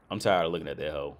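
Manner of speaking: usual speaking voice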